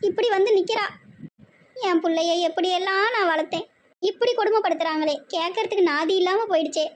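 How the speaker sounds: high, hammed-up lament; tearful wail. Mother telling sister-in-law of daughter's suffering, tearful wail. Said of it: "ரெண்டு பேரும் அழுவாதீங்க".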